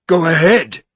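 loud, daring taunt; a loud, mad-eyed dare; insane bravado. Acted. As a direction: dare him to hit, reckless. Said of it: "insane, palsied bravado".

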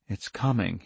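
low, soft, helpless inner voice; helpless thought. A narrator's reading. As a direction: think it helplessly, under your voice. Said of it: "he thought helplessly".

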